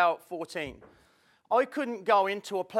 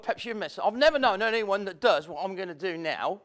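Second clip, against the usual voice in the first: ordinary talk, nothing marked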